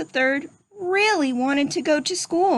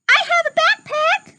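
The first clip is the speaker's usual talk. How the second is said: high squeaky piglet voice, eager; little pig voice. Acted. Teacher reading Rufus introducing himself, high piglet voice.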